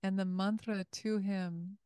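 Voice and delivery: normal talking voice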